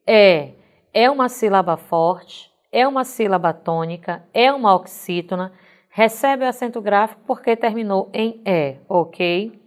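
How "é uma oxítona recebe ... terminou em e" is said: usual speaking voice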